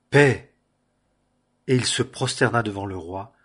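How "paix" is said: a loud cry of greeting; cried out. A narrator's reading. Runner crying out to the king, loud. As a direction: call out peace to the king. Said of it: "Akhimaatz cria et dit au roi".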